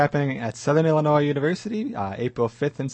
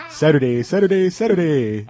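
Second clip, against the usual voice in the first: monster truck ad; 3 semitones higher, 4 dB louder than usual. Pretend. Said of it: "the monster truck rally ads".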